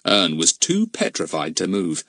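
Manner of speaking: plain reading voice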